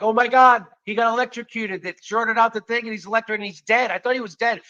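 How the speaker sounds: high, alarmed outburst; retold panic. Club owner finding the lights out, fearing death, panicked. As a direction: blurt it high-pitched, acting out mounting alarm. Finds host seemingly electrocuted, retold.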